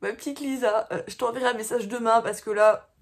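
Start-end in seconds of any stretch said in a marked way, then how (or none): none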